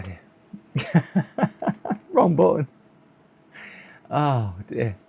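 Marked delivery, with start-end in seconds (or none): none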